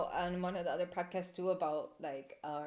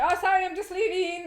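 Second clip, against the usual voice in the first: higher, louder chirpy excuse; fake sweet excuse; 10 semitones higher, 9 dB louder than usual. Dodging an unwanted visitor at the door, high and chirpy. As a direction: brush the caller off sweetly.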